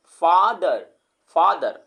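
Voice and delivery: "Father" loudly split, फा-दर; loud two-beat demonstration of "father"; separated syllables. Shown as a demonstration. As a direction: hit both beats of "father", long फा then दर. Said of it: "फा अलग, दर अलग".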